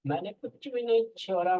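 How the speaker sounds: high-pitched emphatic protest; emphatic insistence. Real, not acted. Insists he left nothing out. (ऊँची आवाज़ में ज़ोरदार दावा)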